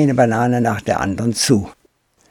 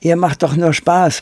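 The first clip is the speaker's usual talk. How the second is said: put-on high child's voice, uncertain; Pole Pole, unsure; 8 semitones higher, 4 dB louder than usual. A narrator's reading. Boy unsure whether the apes are playing, uneasy. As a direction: protest weakly in a raised boy's voice. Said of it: "Pole Poles Lachen blieb ihm im Halse stecken".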